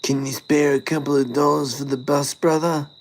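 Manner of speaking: high, wheedling eshay voice; Ashay accent. Pretend. Eshay asking for bus money, high mock eshay accent. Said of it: "I'll say it again".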